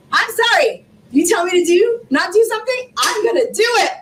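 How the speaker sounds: rising, fired-up defiance; high, punchy, stubborn insistence; defiant. Real, not acted. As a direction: dare anyone to stop you. Defiant about people doubting her.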